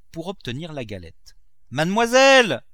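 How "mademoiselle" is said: high, loud call through a window. A narrator's reading. Cook hailing the young mistress through the window, shouted call. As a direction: shout up to someone out of sight. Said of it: "cria-t-elle par la croisée".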